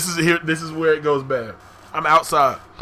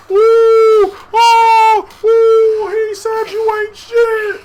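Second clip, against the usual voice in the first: put-on high, loud drawn-out hooting like a crowd of kids; whooping schoolkids stirring up a fight; egging-on crowd; 7 dB louder, much slower than usual. Everybody in middle school goading a fighter, high loud jeering.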